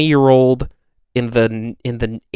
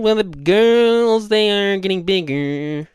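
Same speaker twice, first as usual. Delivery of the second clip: very slow, higher mock drawl; 9 semitones higher, much slower than usual. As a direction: stretch it out like the singer. Continuing the [name] impression.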